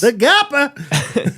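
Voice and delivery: high, slow grand announcer. Pretend. Mock grand announcement of name.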